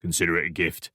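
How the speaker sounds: lightly voiced Cockney stranger; offhand Cockney handover; Cockney accent. A narrator's reading. Stranger handing Alex a phone, offhand Cockney accent. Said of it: "thick Cockney accent".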